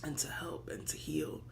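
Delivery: soft whispered close; whispered, reverent. Real, not acted. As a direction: hush it out tenderly. Tender hushed sermon line.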